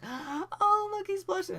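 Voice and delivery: very high, loud teasing squeal; loud falsetto mockery; mock teasing classmates. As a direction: imitate a crowd cooing to embarrass someone. Classmates teasing him, acted out.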